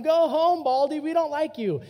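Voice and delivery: loud, high taunting yell; mock yelling kids. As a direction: jeer at him like a mean kid. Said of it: "they're just yelling at him".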